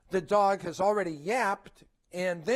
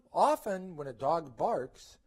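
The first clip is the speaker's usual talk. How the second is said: ordinary delivery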